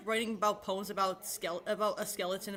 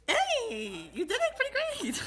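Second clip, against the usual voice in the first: much higher, bright squeal of praise; excited praise; 4 dB louder, slower than usual. Real, not acted. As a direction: cheer your friend on way up high.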